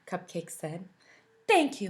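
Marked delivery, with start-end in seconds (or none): 1.5-1.9 put-on high, louder girlish thanks; a bright high thank-you. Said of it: "Milk answered".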